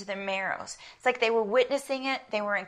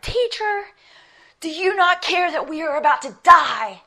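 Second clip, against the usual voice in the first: high, loud, exasperated mock plea; sarcastic; 8 semitones higher, 11 dB louder than usual. Disciples confront Jesus in the storm, sarcastic. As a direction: imitate panicked disciples laying into their teacher. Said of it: "hear the sarcasm in their voices".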